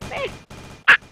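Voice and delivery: short quacking duck noise. Shown as a demonstration. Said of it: "My down duck impression right there".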